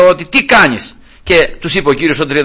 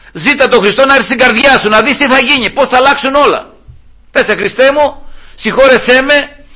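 raised, pleading preacher's call to prayer; fervent preaching; 7 semitones higher than usual. Acted. Preacher urging the congregation to invite Christ, fervent and raised. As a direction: lift the voice and urge them to pray.

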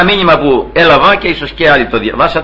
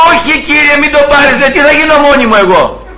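much higher-pitched protest, run on without breaks; imitated pleading wife; 8 semitones higher than usual. Preacher voices the wife begging the Lord not to take her husband, pleading. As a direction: plead as a wife afraid of being left alone.

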